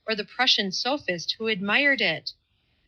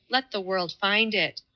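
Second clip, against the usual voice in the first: ordinary delivery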